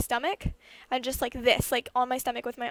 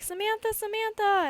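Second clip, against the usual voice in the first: much higher call of the name; 10 semitones higher, 3 dB louder than usual. Retold or imitated. Injured kid calling her sister from the yard, high pitched call. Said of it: "so I'm just like".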